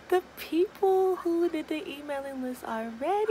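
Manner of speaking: high, loud laughing through the words; nervous laugh. Real, not acted. Nervous store launch email.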